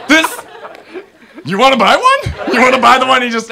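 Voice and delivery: high, loud, laughing sales pitch; laughing disbelief. Retold or imitated. Asking the mom to buy the bitten soap, loud and laughing. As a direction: pitch it through laughter, incredulous.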